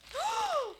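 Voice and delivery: sharp high gasp of surprise; high delighted gasp. Real, not acted. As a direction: gasp at what's inside. Opens the second package.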